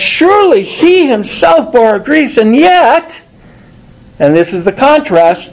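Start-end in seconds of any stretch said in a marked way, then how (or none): 0.0-3.2 raised preaching pitch. Acted. Preaching the contrast.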